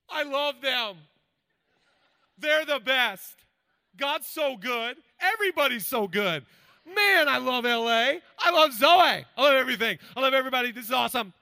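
loud, high, giddy gushing; gushing joy. Pretend. Heart just touched by God, gushing loud and giddy. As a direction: overflow with delight. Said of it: "we're like".

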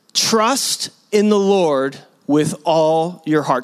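slow, every word weighed; measured scripture reading; slow recitation. Shown as a demonstration. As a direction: read it out slowly like scripture. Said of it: "Can we say that all together?".

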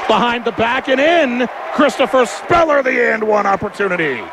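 rising to a big excited shout; excited play-by-play. Real, not acted. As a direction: call the basket at full excitement. Big basket, excited call.

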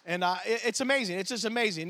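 ordinary delivery

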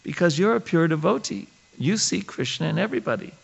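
put-on a young devotee answering her guru sweetly; sweet innocent voice. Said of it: "I'm trying to imitate her".

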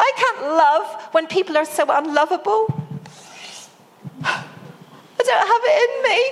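retold weeping protest, pitched high throughout; between sobs. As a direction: replay the tearful complaint to God. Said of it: "I said between sobs".

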